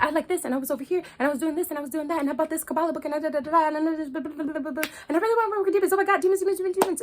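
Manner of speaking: high, fast, breathless excited babble; mock giddy past self. Imitating her old self to the priestess, high and giddy. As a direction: gush like an overeager newbie. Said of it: "I'm like".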